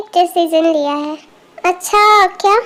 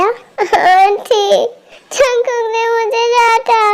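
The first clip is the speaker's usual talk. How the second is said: drawn-out tearful wail, acted; crying child; 3 semitones higher, much slower than usual. Little girl tells aunt she was scolded, crying. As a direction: complain through hammed-up sobs. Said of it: "तुम रो क्यों रही हो".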